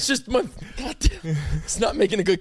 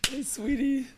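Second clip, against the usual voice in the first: higher, much softer sweet greeting; a light mock of his usual sweet voice; sweet voice imitation; 4 semitones higher, 7 dB quieter than usual. Husband imitating how his wife hears him, softer and higher. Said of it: "she thinks I'm like this".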